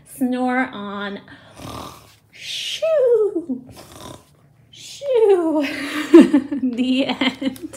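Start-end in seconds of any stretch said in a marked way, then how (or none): 2.3-5.7 high, loud shoo falling off. Pretend. Final shoo refrain. 6.1-7.8 laughing through the end line. Real, not acted. Laughs finishing the story.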